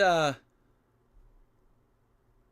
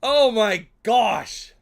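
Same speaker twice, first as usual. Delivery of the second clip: much louder, higher, slow "oh my gosh"; loud relieved exclamation; 8 semitones higher, 13 dB louder, much slower than usual. Real, not acted. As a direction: drag out the relief. Relief after long struggle.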